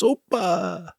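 high cheerful Greek Opa; Greek exclamation. Retold or imitated. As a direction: shout Opa like a Greek toast. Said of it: "Wow, Greek".

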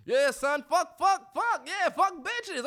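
high-pitched hyped-up bro cheering; mock bro hype. Dad acting like his son's bro, mock hype. Mocks dad being bros with son.

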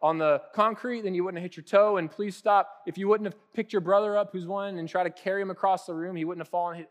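exasperated parental lecture; lecturing parent. Retold or imitated. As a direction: lay out the cold hard facts to a toddler. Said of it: "Well, it's like".

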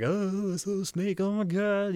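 high squeaky mock panic over a little snake; imitating scared wife. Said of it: "she was like".

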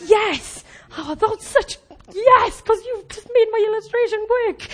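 high, excited yes; delighted exclamation. Retold or imitated. Preacher recalling her delight at Jenny making her illustration work, excited. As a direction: exclaim it, pitch jumping up in delight. Delighted retold reaction to friend.

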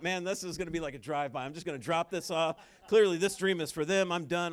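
mock bravado, brushing it off; cocky inner voice. Preacher mimicking his own cocky younger self. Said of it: "Like, I thought".

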